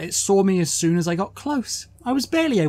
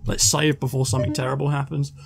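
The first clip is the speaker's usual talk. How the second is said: ordinary talk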